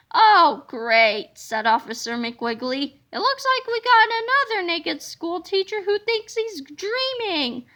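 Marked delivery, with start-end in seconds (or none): 0.1-1.3 sarcastic sigh. A narrator's reading. Weary cop on scene. 3.1-7.7 fed-up cop. A narrator's reading. Cop voiced in story.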